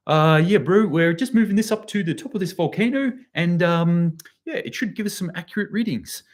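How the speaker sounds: mock a Kiwi official in a New Zealand accent; bad New Zealand accent. Kiwi official explaining the moved stations, in a hammed-up New Zealand accent. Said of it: "my shitty New Zealand accent".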